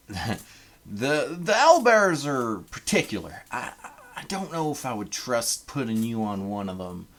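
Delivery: put-on a Canadian's rough try at a Southern accent; Southern American accent. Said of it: "this is a Canadian trying to do a Southern American accent".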